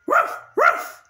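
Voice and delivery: very high squeaky puppy woofs; bark like a dog. Pretend. Simon Says game, high squeaky dog bark. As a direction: yip it out like a tiny dog. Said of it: "bark like a dog".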